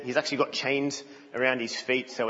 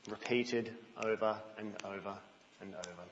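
much softer, trailing away to almost nothing; weary fading; 3 semitones lower, 10 dB quieter than usual. Acted. Preacher showing the congregation life's endless repetition, fading weary voice. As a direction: let it die out as if worn down.

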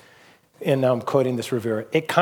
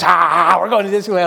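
excited cheer; 4 semitones higher, 8 dB louder than usual. Retold or imitated. Son tackling brother, cheering.

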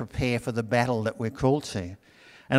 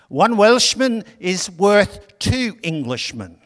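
put-on high, slow, self-confessed poor Welsh accent; a sermon teller's shaky go at a Welsh voice; bad Welsh accent; 6 semitones higher, 6 dB louder, slower than usual. Preacher voices a Welshman calling from the fog, in a poor Welsh accent. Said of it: "I can't do a Welsh accent".

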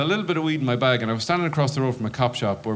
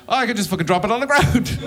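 stoned realization; 9 semitones higher, 3 dB louder, faster than usual. Retold or imitated. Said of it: "I just realized".

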